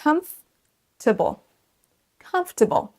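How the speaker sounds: comfortable as COMF-ta-ble, high first syllable, falling; model for repeating. Shown as a demonstration. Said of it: "Essayez de répéter après moi". (comfortable en trois syllabes, à répéter)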